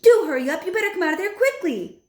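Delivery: high, loud call to hurry; urgent call. A narrator's reading. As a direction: hurry him out, calling. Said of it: "my grandmother shouted".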